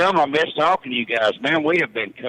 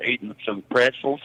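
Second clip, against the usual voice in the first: ordinary talk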